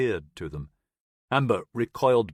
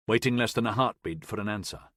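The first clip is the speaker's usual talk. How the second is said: ordinary delivery, nothing marked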